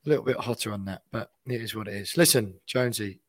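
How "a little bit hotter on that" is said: usual speaking voice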